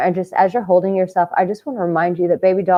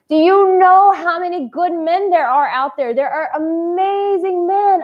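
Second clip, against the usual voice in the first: excited; 10 semitones higher, 4 dB louder than usual. Real, not acted. Emphatic high quote to women.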